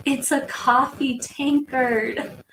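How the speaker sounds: put-on playful stab at a South African or UK accent; attempted UK or South African accent. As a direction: put on a cute little UK accent, drawn out. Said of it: "like, messed that accent up".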